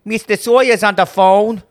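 hammed-up working-class secretary; common accent. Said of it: "a much more common accent".

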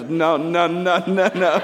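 mock-terrified kid voice; frightened little boy. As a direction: repeat it like a scared small kid. Voices his terrified son backing away.

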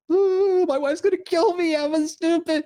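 comic high-voiced dread before the CEO speaks; mock panic. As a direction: pitch way up like a terrified kid. Panicking in boss's office.

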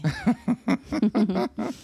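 bursting high laugh; loud laugh. Real, not acted. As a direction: laugh out at the joke. Husband laughs at sultry voice.

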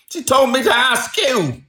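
high, loud plaintive whine; whining protest. A narrator's reading. Little man blaming Missus Oakshott to the salesman, whining. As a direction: plead back in a high voice.

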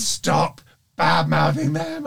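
slow, firm telling-off; stern scolding. Retold or imitated. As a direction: scold him slowly and firmly. Bianca scolding him.

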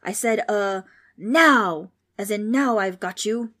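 loud, slow menace; roaring ghost. A narrator's reading. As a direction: roar it to cover a slip. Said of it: "the ghost roared".

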